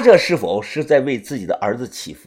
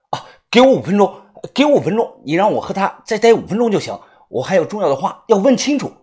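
urgent pleading; 5 semitones higher, 4 dB louder, faster than usual. Acted. Pleading with orderlies.

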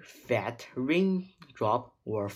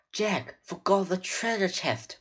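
high-pitched urgent cry; 7 semitones higher, 3 dB louder than usual. A narrator's reading. Girl urging her brother to leave, crying out. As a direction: cry out high to pull them away. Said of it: "cried Annie".

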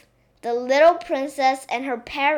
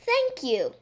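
ordinary delivery, nothing marked

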